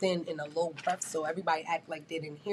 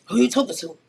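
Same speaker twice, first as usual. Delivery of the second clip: retold angry retort; 4 semitones higher, 8 dB louder than usual. Coworker's retort in argument.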